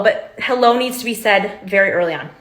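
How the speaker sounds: normal talking voice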